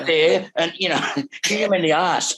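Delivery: imitating a boozy manager urging him on; drunk manager egging on. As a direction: push him back on stage, half-drunk. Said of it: "tipping cognac down my throat and going".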